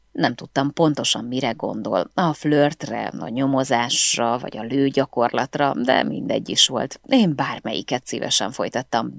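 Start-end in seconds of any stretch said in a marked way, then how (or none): none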